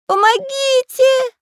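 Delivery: very high, drawn-out cartoon call; faint cry for help. A narrator's reading. Lost pencil calling friends from afar, crying for help. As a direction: cry out slowly in a squeaky little voice. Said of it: "раздался неожиданно слабый голос карандаша".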